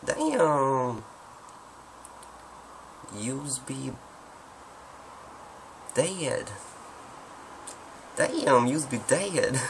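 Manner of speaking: high, drawn-out American southern drawl; exaggerated southern US twang; southern accent. Shown as a demonstration. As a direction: stretch the vowels like an American southerner. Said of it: "sounds kinda more like a southern accent".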